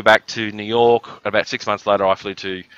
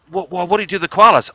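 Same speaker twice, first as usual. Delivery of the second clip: higher, louder stammered question; a stunned guest tripping over the question; mock excited guest; 9 semitones higher, 5 dB louder, faster than usual.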